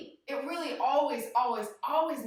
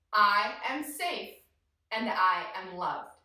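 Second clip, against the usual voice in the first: slow, measured affirmation; calm mantra; 4 semitones lower, slower than usual. Shown as a demonstration. Teacher reminding kids of the mantra, slow and calm. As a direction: say it slowly, settling lower at the end.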